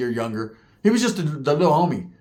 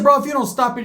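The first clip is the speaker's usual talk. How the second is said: higher, louder warning; heated warning; 10 semitones higher, 4 dB louder than usual. Retold or imitated. Warning Gabe off, heated, much higher and louder. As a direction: snap back hard at the Crip. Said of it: "I said".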